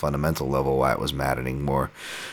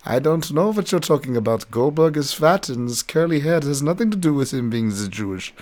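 put-on German accent; 10 semitones higher than usual. The German coach hands out skates, in a hammed-up German accent. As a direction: play the shady German coach in a mock German accent. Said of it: "with his thick German accent".

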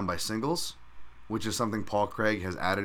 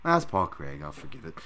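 ordinary delivery

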